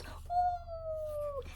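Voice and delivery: high, airy woo; gleeful woo. Acted. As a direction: let the woo fly up with the bounce. Kitten bouncing on bed.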